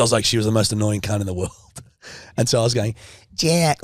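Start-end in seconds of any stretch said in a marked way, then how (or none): 3.3-3.8 put-on American accent. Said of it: "I was doing her accent".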